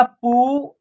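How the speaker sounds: पू with the ऊ held; long vowel. Shown as a demonstration. Teacher spelling out a word, पू held long. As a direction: draw out the vowel in पू. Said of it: "आप long तरीके से उच्चारण".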